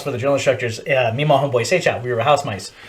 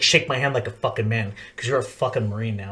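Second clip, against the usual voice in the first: low, soft instructor voice; quietly firm, voice dropped; retold kill hat; 5 semitones lower, 7 dB quieter than usual. Kill hat shaking a new Marine's hand, low and firm. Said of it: "He's like".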